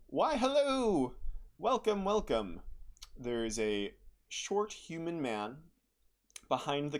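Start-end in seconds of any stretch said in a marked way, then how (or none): 0.1-2.6 put-on high, cheerful old shopkeeper; jolly shopkeeper. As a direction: beam at the customers. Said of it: "greeted by a jolly voice".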